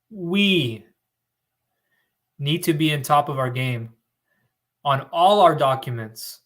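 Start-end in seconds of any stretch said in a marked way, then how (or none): 0.1-0.9 "We" set apart after a pause, a bit higher; single out "We" as the point; emphasized key word. Shown as a demonstration. Host stresses the viewer's word to the audience, pointed "We". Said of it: "let me emphasize that key word".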